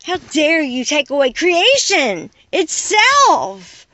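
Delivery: usual speaking voice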